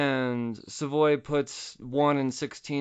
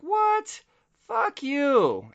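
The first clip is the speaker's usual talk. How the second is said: mock outraged squeal, far higher; shocked outburst; 14 semitones higher, 4 dB louder, much slower than usual. Cops hearing the body was cremated, squealing in mock outrage. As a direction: blurt it like a cartoon double take.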